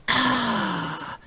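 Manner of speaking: high drawn-out "ah" of a pod opening; spore pod noise. Acted. Game master sounds out the spore pod opening, high hiss. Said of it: "big spore pod that goes".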